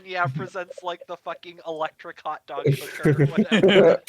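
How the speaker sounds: ordinary talk, nothing marked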